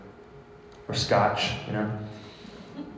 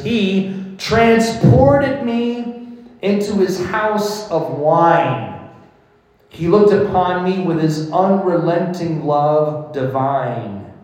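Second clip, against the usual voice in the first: louder and higher, slow, swept up in the verse; fervent poem reading; 7 semitones higher, 6 dB louder, slower than usual. Acted. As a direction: read it like rapture.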